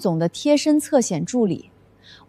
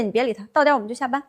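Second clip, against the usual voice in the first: ordinary talk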